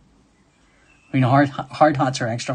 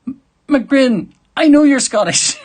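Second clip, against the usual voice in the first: high, loud playful protest; loud, high-pitched teasing outburst; mock exasperation; 12 semitones higher, 8 dB louder than usual. Real, not acted. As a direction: call out in mock exasperation, voice shooting right up.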